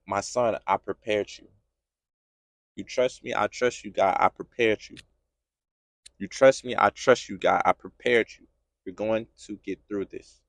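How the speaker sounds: very low, soft fatherly voice of God; quiet reassurance. Acted. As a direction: reassure a hurting son in hushed tones. God's quiet dialogue with his son.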